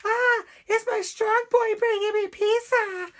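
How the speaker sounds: overdone fake Spanish accent, high and breathless-sounding; mock Spanish woman greeting the pizza boy, high fake accent; bad Spanish accent. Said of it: "Trey Parker doing a Spanish woman's voice".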